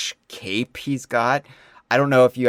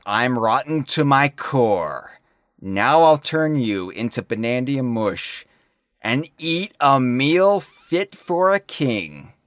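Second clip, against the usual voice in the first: villain voice; 4 semitones higher, 4 dB louder, slower than usual. Acted. Reading K. Rool's villain lines.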